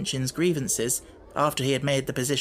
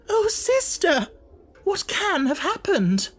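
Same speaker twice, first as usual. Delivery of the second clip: much higher, slow crying plea; tearful wail; 4 dB louder, slower than usual. Acted. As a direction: wail it helplessly. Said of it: "wring her fat hands and cry".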